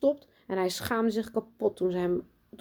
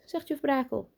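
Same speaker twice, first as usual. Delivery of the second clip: ordinary delivery, nothing marked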